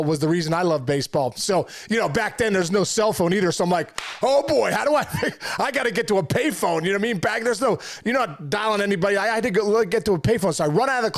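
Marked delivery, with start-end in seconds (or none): 4.2-6.8 excited self-quote. Retold or imitated. Retelling scramble for a payphone.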